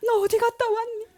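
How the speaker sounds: high-pitched tearful questioning; imitated weeping mother. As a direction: act out a mother asking through sobs. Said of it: "어머니도 막 울면서". (울면서 묻는 엄마 흉내)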